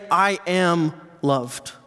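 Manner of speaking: slow, sincere declaration; "I am loved", said slowly; slow affirmation. Shown as a demonstration.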